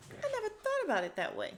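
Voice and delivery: very high, quick musing; playful high-pitched wonder; mock pondering. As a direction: muse it in a squeaky high pitch. Mock pondering of cow explanation.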